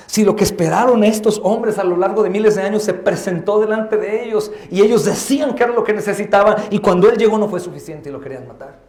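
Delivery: normal talking voice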